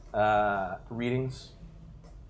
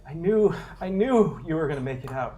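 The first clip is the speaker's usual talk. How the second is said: high, loud, relieved survivor voice; eager comms contact; 7 semitones higher, 9 dB louder than usual. Acted. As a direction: gush with relief over the radio. GM voices NPC Kyle.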